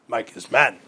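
stiff robot voice saying "Mike is man"; flat robotic robot voice. Shown as a demonstration. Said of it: "almost like a robot".

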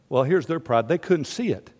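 usual speaking voice

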